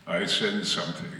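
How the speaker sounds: lightly acted low villain; a hint of low, brooding menace; Darth Vader. Actor replays Vader's moment for the audience, low understated menace. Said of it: "his movement as Vader".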